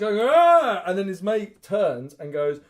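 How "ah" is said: very loud, high mock scream; imitated attack roar. Imitates monster's attack cry.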